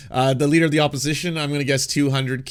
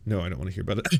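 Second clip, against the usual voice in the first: ordinary delivery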